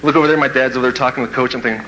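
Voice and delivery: usual speaking voice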